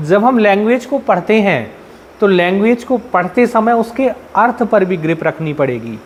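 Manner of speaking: usual speaking voice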